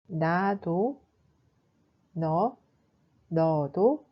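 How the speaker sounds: ordinary talk, nothing marked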